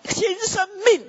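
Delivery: high shouted call of new life; shouted to neighbour. Shown as a demonstration. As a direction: shout it out to the person beside you. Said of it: "跟隔壁人说".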